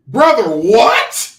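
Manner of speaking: loud, drawn-out disbelief; incredulous outburst. Acted. Host reacting to a flimsy rumor, incredulous.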